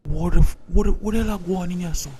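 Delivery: loud angry yell bursting in; angry shout. Acted. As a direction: shout at them, caught off guard. Father bursts in on quarrel.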